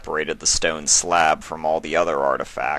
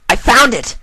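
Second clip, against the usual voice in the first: high, loud, excited shout; 12 dB louder than usual. Acted. Filly shouting her discovery to her uncle in a loud voice. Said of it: "she shouted".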